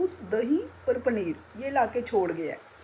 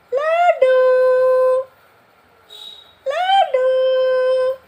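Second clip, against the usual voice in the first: calling out; 14 dB louder, much slower than usual. Real, not acted. Calling her pet from afar.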